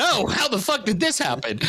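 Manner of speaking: high mock shock; mock surprise. Big guest mocks himself waking up, fake surprise. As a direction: act out a fake startled wake-up. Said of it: "I woke up and went".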